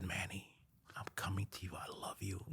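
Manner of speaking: soft unvoiced whisper, tender; whispered confession. Belgian guy whispering thanks to Manny, whispered. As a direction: hush it like a secret love note.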